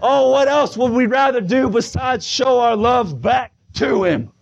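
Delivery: high, fervent revival delivery; full-force preaching. Real, not acted. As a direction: cry it out with drawn-out key phrases.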